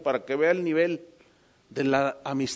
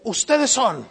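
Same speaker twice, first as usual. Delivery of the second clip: stranger's declaration; 5 semitones higher, 6 dB louder, slower than usual. Retold or imitated. Stranger repeats his greeting.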